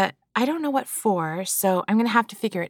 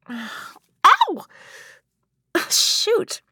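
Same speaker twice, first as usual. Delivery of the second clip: sudden high yelp then laugh; real pained yelp; 12 semitones higher, 4 dB louder than usual. Real, not acted. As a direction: cry out from a poke in the eye. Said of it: "are you laughing".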